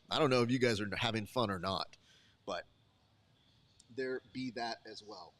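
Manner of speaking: ordinary delivery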